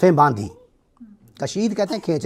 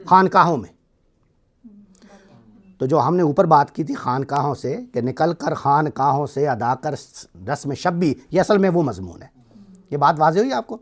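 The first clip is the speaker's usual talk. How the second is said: ordinary talk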